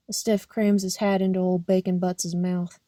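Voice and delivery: ordinary delivery, nothing marked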